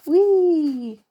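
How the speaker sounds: loud, high, delighted squeal; gleeful whee. Acted. Squirrel swinging on the doorknob, squealing with glee. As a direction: let it fly out with glee. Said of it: "said Timmy".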